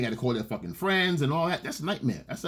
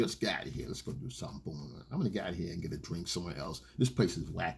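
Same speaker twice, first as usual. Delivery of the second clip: much softer and lower, half whispered; low exit line; 9 semitones lower, 10 dB quieter, faster than usual. Retold or imitated. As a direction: slip her the exit line low and quiet. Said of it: "or whatever you wanna say".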